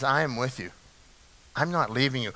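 soft, tender promise. Acted. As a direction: say it like a gentle promise to someone afraid. God's promise quoted, then echoed.

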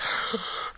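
quick unvoiced panting gasp like an excited dog; dog's eager gasp. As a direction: gasp the way the dog does when it comes flying. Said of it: "and he's like".

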